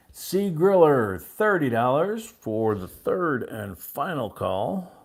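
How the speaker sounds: loud, very slow, sweeping auction call; auctioneer's final call. Real, not acted. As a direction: belt it out slowly, drawing out each phrase.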